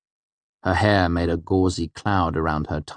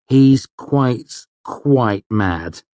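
higher, emphatic complaint; exasperated; 6 semitones higher, 7 dB louder, much slower than usual. A narrator's reading. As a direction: stress it in exasperation, falling away. Complaining to friends about mentor.

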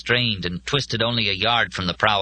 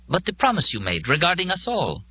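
insisting; 11 semitones higher than usual. A narrator's reading. Molly argues Joe's responsibility to him.

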